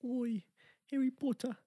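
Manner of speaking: a high, hammed-up British accent; jokey British voice; mock British. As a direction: say it like a cheeky London kid. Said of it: "me accent".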